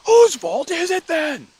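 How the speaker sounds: loud, very high, drawn-out demand; acted exasperated shout; angry. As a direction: yell it at a sibling, stretching the words. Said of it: "the anger rose up".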